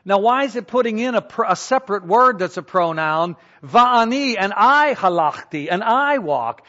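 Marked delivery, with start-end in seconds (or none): 3.6-5.7 Va'ani said higher and louder, then halachti; emphatic Hebrew. Shown as a demonstration. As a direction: stress the pronoun in Va'ani. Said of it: "put an emphasis on the subject".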